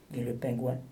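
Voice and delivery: usual speaking voice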